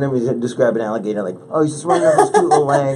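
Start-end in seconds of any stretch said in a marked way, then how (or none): 1.5-3.0 high, loud, amused gushing; mock cutesy. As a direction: gush teasingly, as if over an adorable pet. Mocking her cute alligator description.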